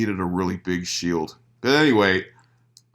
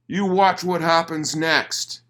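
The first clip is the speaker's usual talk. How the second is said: mock threat; 9 semitones higher, 4 dB louder, slower than usual. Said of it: "and he says".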